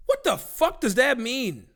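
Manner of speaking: high, loud, drawn-out disbelief; incredulous outburst. Acted. Streamer reacting to a radioactive shrimp headline, incredulous outburst. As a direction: blow up at absurd news.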